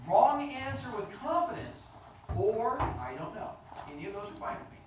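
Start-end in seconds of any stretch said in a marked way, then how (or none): 0.1-1.8 loud, drawn-out, higher delivery; confident. Shown as a demonstration. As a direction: announce it slowly and boldly. Models the confidence wanted from pupils.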